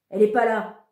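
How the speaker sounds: put-on furious child cutting off a stranger on the phone; curt angry retort. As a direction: bite it off and slam the phone. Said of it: "en raccrochant violemment".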